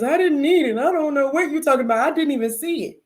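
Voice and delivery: high, tearful little-sister protest, mock sobbing; imitating crying sister. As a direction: play the sister wailing her denial through tears, hammed up. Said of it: "My sister broke out of tears".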